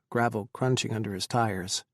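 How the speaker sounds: usual speaking voice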